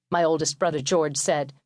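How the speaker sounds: plain reading voice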